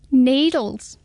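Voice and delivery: high, small squeaky creature; a tiny curious hedgehog peering up; high little hedgehog, puzzled. Acted. Hedgehog speaking.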